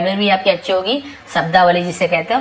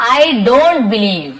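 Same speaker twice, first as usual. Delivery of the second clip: slow demo; 3 semitones higher, 6 dB louder, much slower than usual. Shown as a demonstration. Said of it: "तो आप क्या बोलोगे?".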